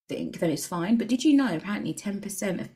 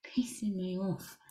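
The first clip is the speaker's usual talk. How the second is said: whispered frustrated aside, just under the breath; under the breath, fed up; 8 dB quieter, slower than usual. Real, not acted. Venting, repeats in a whisper.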